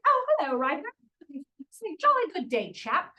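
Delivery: hammed-up British greeting; British accent. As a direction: play along in a jolly British accent. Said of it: "your British son".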